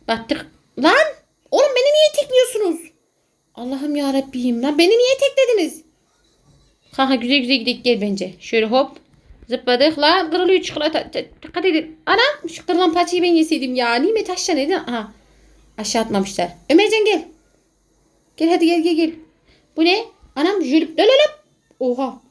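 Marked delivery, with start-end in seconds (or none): 0.8-5.8 high indignant cry; outraged surprise. Real, not acted. Friends tripped him in game. 21.0-21.3 high playful cries; excited yelp. Real, not acted. Mock exclaim in game.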